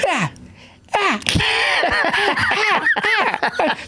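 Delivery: high yelping cries, hammed-up; pained yelps. Friend mocking his buddy in the cold shower, high hammed-up yelps. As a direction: yelp like a belt is hitting you. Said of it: "I just heard him going like".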